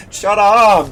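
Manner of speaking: very high, loud mock shriek; shrieking shout. Real, not acted. Gamer yelling at a loud tank, shriek. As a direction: shriek at the noise.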